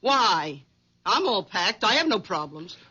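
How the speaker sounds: low, nagging housewife voice; low, bossy nagging wife. Acted. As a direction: scold him like a domineering wife.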